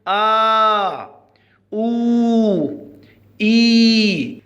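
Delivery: آ، أو، إي each held long and separately; long vowels. Shown as a demonstration. Teacher showing first graders the long vowels, آ، أو، إي drawn out. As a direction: stretch out آ، أو، إي one by one. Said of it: "ما هي الأصوات الطويلة".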